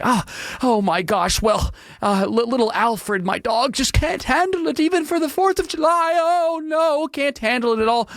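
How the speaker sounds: high, whiny, fussing over poor little Alfred; mock whiny dog owner. Mocking a dog owner to listeners, high whiny voice.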